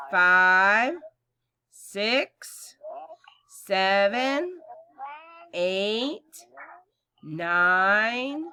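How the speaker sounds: slow, loud count-along; counting with kids. Acted. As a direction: count out for the class. Counting aloud with class.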